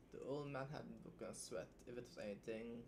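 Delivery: soft, quiet reading aloud; story reading. Acted. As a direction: read the passage softly.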